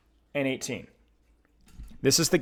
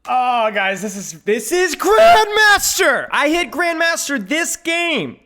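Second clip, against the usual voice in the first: loud, high, slow triumphant shout; excited; 11 semitones higher, 9 dB louder, slower than usual. Real, not acted. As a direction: announce it like a big win. Hitting Grandmaster on replay.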